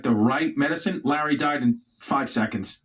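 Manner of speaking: ordinary talk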